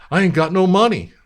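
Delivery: put-on high, loud broke kid. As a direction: blurt it out. Young [name] voiced.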